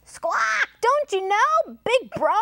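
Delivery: high parrot squawk, incredulous question; screechy storybook parrot. A narrator's reading. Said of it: "asked the parrot".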